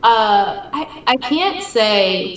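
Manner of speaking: choked up, slow and halting; fighting back tears mid-question; teary-eyed. Real, not acted. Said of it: "sorry, I'm getting all teary-eyed".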